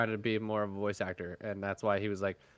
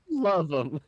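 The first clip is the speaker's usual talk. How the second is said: laughing; 6 semitones higher, 3 dB louder than usual. Real, not acted. Teasing repeat of friend's phrase.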